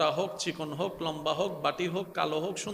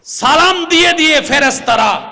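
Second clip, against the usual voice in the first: louder preaching call; 7 semitones higher, 14 dB louder than usual. Acted. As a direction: proclaim it to the whole hall. Preacher shouting the promise.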